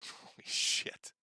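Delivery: whispered laughing disbelief; breathy laugh. Real, not acted.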